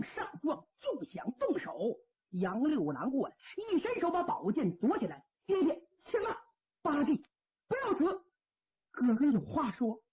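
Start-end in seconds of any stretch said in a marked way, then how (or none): 5.4-8.2 high, crying urgently; tearful shout to his brother; alarmed plea. Acted. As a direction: stop him from dying.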